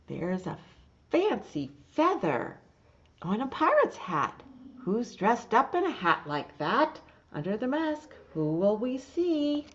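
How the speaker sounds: lively, loud storybook reading with animal voices; reading to children. Acted. Teacher reading Little Blue Truck's Halloween to the class, lively loud reading. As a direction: read it big and bright for little listeners. Reads picture book aloud.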